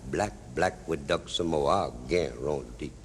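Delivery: dropping low and soft, slowing at the end; drawn-out catchphrase. Acted. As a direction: savour the words as the pitch sinks. Same catchphrase capping the repeated scene.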